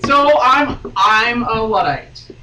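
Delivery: loud, slow, put-on declaration; mock confession. Real, not acted. Host owns up to being old-fashioned, loud and slow. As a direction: proclaim it like a dramatic confession.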